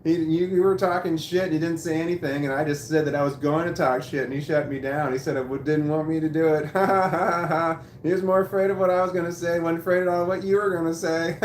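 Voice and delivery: loud, high, gloating taunt; mocking laughter. Employee taunting shift manager who got overruled, gloating mockery. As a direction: jeer at him with big fake ha-has. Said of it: "I started laughing and pointing".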